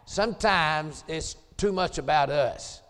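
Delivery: ordinary delivery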